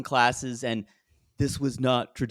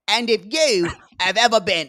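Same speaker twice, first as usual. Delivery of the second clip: louder, higher English accent on "And if you have ever been"; 7 semitones higher, 7 dB louder than usual. Shown as a demonstration. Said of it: "No, do it in the accent".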